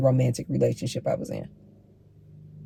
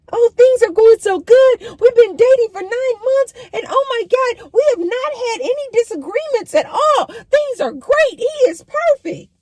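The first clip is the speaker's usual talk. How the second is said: much higher, falsetto gushing, slowing at the end; mock gushing girlfriend; 4 dB louder than usual. Mocking a woman bragging about her perfect man, high gushing. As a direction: play a naive woman in love. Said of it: "I would tell women this".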